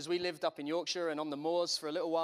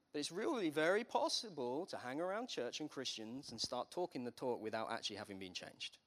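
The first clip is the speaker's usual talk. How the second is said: normal talking voice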